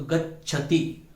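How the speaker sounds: गच्छति with a clear half च then छ, said slowly; careful pronunciation. Shown as a demonstration. Teacher shows students the right sound, said slowly and carefully. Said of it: "इन दोनों की ध्वनि सुनाई देनी चाहिए".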